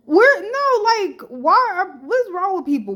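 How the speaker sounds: real exasperated shriek; outraged outburst. Real, not acted. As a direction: burst out in outrage at them. Outraged at fans' comments.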